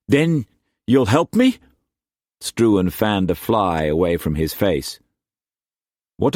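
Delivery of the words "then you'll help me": hopeful Cullum. Acted. Cullum hopes for help.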